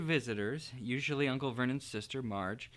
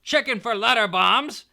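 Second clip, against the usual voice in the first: loud, high sneering jeer; mocking Uncle Vernon; 9 semitones higher, 12 dB louder than usual. A narrator's reading. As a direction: taunt the boy with a loud joke.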